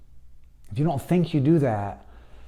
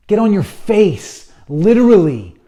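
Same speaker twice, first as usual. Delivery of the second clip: loud, high preaching command; fervent command; 7 semitones higher, 10 dB louder than usual. Real, not acted. Preacher ordering listeners to fall down before God, loud. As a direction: demand it with full force.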